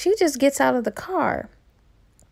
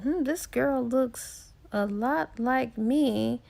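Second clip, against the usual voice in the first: slow, soft muttered thought, half to himself; musing to himself; 6 dB quieter, slower than usual. Retold or imitated. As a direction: ponder it quietly, slowly, as you notice the resemblance. Husband musing at daughter's photo.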